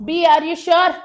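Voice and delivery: ordinary talk, nothing marked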